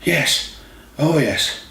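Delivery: eager yes. A narrator's reading. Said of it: "reaction was instant".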